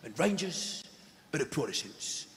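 mock hard-man documentary presenter; Danny Dyer. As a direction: deliver it to camera in weighty, separated phrases. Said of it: "he's talking to the camera".

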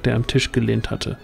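ordinary talk

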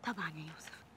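hushed loving reassurance; tender whisper. Acted. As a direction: whisper it close to him. Noura reassuring lover. (همس حنون)

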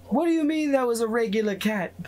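high put-on voice, questioning; Tharis's voice. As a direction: squeak the question out in character. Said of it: "Tharis just goes".

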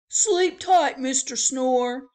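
loud, high, cheery bellhop welcome; chirpy old-fashioned bellhop. Acted. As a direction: call out the welcome like a grand hotel porter. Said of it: "bellhop's goodnight".

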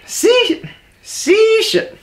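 high-pitched strained try; frustrated squeak. Real, not acted. Fumbling tongue twister.

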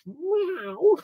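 a high, soft, squeaky mock meow; bad cat meow. Host answering Mario cat quiz, high mock meow. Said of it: "Very bad cat impression".

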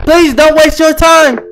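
high, loud, drawn-out telling-off; slow it down and push it up high; scolding read-out. Acted. Gamer reads out the operator's rebuke, loud and scolding.

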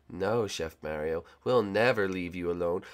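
low menacing voice. Acted. Minion taunts Mario.

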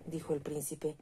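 plain reading voice

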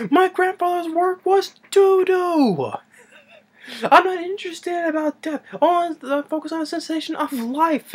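very high, frantic squealed protest; mock hysterical outburst. Professor disowning his grandfather's work, high hysterical outburst. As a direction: imitate a professor losing it, far up in pitch.

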